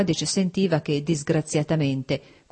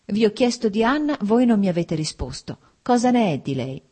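urgent, pressing plea, pitch higher at first; desperate resolve; 5 semitones higher, 4 dB louder than usual. A narrator's reading. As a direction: demand an answer in desperation. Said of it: "con una decisione disperata". (con decisione disperata, più acuta)

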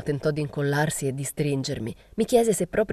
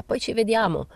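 pitched up like calling after someone; mild narrated call; 7 semitones higher than usual. A narrator's reading. Someone calls to Morelli over his shoulder, mild call. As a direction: call it out lightly, higher. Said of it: "che gli gridava".

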